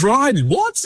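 high, astonished question to Ryan; a lightly voiced shocked workmate; surprised mate. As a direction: jump up high in disbelief. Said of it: "He goes".

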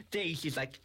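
ordinary delivery

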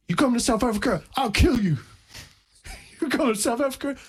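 imitated African man. Retelling a man's repeated threat, in a high-pitched African accent. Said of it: "an African guy".

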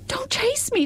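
very high, frightened cry of chase; plead, scared, with chase falling; frightened little voice. Acted. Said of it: "such a frightened little voice".

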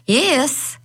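high, mildly cheered yes; cheering. A narrator's reading. Lisa hears Stanislaw changed his mind and says yes, cheering. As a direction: cheer it as Lisa, pitched well up. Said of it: "Lisa cheered".

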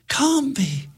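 high stricken gasp; gasped between sobs. Acted. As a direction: disbelief through tears. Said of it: "gasped Milena between sobs".

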